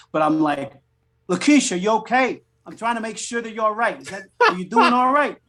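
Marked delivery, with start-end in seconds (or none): 1.3-2.4 higher, exaggerated New York cop; cartoony New York accent. Actor told to be more New York, cartoony New York accent. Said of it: "I sound like the Dice Man". 2.7-5.4 higher, cartoony New York cop; Dice Man, New York accent. Cop checking on Lakeisha, cartoony New York accent. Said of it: "I sound like the Dice Man".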